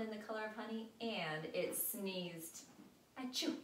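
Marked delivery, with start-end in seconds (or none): 3.2-3.6 high, loud fake sneeze; a little hamster sneeze. A narrator's reading.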